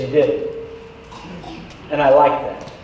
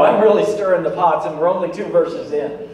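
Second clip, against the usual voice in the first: ordinary delivery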